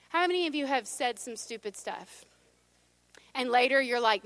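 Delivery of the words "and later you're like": usual speaking voice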